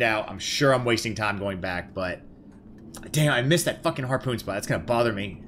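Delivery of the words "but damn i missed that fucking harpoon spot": ordinary delivery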